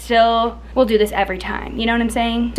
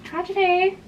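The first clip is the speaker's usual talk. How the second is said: mock tragic; 8 semitones higher than usual. Forgot before photos, mock dismay.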